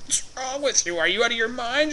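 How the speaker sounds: winded squeak. Retold or imitated. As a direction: squeak it out with no air left. Winded after punch, coughing.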